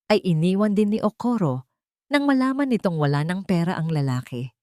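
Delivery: plain reading voice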